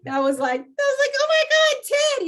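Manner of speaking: high squealing laugh through the words. Real, not acted. Retelling her reaction to her mentor Ted, laughing high and loud. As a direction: shriek it laughing in disbelief. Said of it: "laugh cry".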